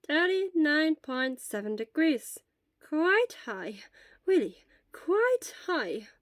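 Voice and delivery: put-on slow, gentle questions from an old nurse; lightly voiced elderly nurse. Elderly school nurse gently questions a feverish boy. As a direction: ask slowly and calmly, like a school nurse.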